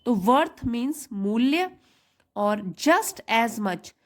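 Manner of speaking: ordinary delivery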